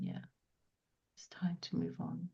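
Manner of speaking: soft whisper. Retold or imitated. Medium voicing the spirit who agrees to move on, whispered. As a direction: breathe the words out gently.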